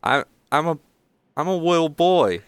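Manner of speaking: put-on high, halting child's voice; little boy. Halfling child answers Finny, in a little boy's voice. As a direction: stammer it as a small kid.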